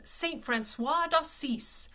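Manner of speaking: "Saint Francois d'Assise" with English SAINT and sounded N's; Anglicised reading. Shown as a demonstration. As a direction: slowly read "Saint Francois d'Assise" as spelt. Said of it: "but the pronunciation".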